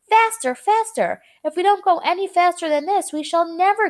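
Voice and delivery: high, loud urging cry; urgent excitement. A narrator's reading. Wonka bangs the wall, urging high and loud. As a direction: push the elevator on, eager.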